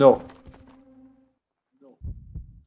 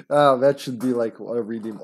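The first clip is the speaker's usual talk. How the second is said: ordinary talk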